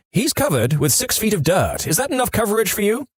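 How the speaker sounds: a touch of grandma snapping back, rising high at the end; sharp retort. Retold or imitated. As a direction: let the old lady bite back with a pointed question. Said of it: "she promptly replied".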